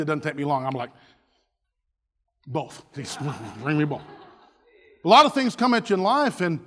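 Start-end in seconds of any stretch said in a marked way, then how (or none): 2.5-4.0 low, soft mock-confiding request; sheepish order. Diner to waiter, low sheepish order. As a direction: ask quietly for the lot. Acts himself ordering food.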